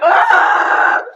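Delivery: high, loud shriek; piercing high yelp; startled scream. Real, not acted. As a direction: scream out in shock. Screaming reaction to video.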